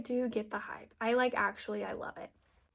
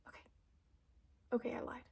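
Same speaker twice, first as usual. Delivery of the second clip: a sheepish little whisper; whispered confession; 12 dB quieter, much faster than usual. Real, not acted. Whispering to viewers that she needed the mirror after all. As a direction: whisper the admission.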